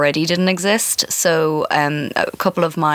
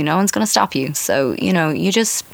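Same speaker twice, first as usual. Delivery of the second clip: ordinary talk, nothing marked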